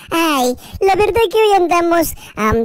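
ordinary talk, nothing marked

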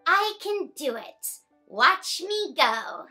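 ordinary delivery

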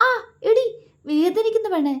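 sharp high yelp of pain; ouch, she pulled hard; cry of pain. Acted. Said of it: "നെറ്റി ചുളിച്ചു പറഞ്ഞു".

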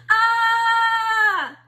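high, loud sung scream; long comic scream; crowd scream. A narrator's reading. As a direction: shriek like a terrified crowd. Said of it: "the townspeople all screamed".

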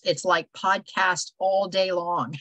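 usual speaking voice

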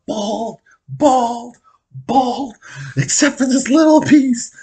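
very high, loud exclamations; squealed, building announcement of a big reveal; gleeful mock outcry. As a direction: shout it in high-pitched comic disbelief.

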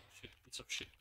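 whispered, frustrated swearing; whispered curse. Real, not acted. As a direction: curse under your breath at the game. Cursing under breath at game.

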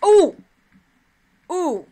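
a loud, high sudden ooh breaking the outro; surprised ooh. Real, not acted. Youtuber interrupted mid-goodbye by a kill, surprised.

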